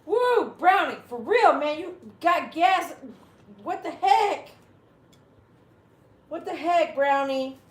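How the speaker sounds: high, loud, drawn-out protest; loud exclaiming. Real, not acted. Host reacting to Brownie's gas, loud exclaiming. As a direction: cry out at a sudden stink.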